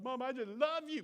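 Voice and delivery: mock child's gushing "love"; gushing kid. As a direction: whine it like a sweet-talking kid. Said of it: "your kid's just like".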